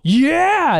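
high, loud cheer; excited yell. Shown as a demonstration. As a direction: whoop it up as you jump. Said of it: "you're supposed to go".

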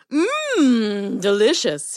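lightly voiced, relishing lion; a hint of a contented lion; savouring lion. A narrator's reading. Lion relishes catching a mouse.

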